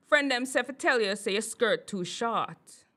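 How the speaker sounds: a firm Jamaican patois retelling; Jamaican accent. Retold or imitated. Retelling the friends' remark about her short skirt in a Jamaican accent. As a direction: voice the boyfriend's friends in Jamaican patois, with a bit of push. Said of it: "So let me say it right".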